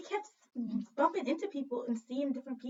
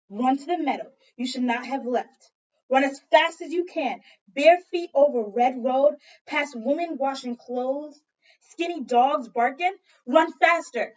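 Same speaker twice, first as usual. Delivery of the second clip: loud, pressing, breathless urgency; urgent command to run faster; 2 semitones higher, 10 dB louder than usual. Acted. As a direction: drive them on to hurry. Urgent chase in story.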